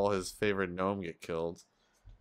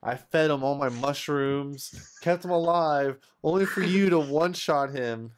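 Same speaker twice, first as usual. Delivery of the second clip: higher and louder, outraged at the loss; indignant rant; 7 semitones higher, 6 dB louder than usual. Acted. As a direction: vent the betrayal, voice rising. Player complains companion was killed.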